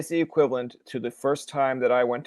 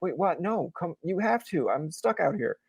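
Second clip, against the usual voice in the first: mock pleading; 4 semitones higher, 6 dB quieter than usual. Goalie protests to deserting teammates.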